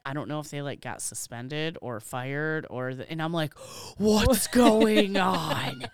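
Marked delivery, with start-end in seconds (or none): 4.0-5.9 slow, loud, stretched-out disbelief; drawn-out what's going on. Retold or imitated. Retelling the store gossip to a cohost, stretched incredulous question. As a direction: drag out each word in mock shock.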